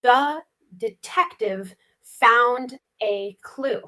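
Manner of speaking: slow, word by word with gaps; slow repetition. Shown as a demonstration. Student repeats the practice sentence after the teacher, slowly. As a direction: repeat it slowly, each word set apart. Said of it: "Let's say it slowly first".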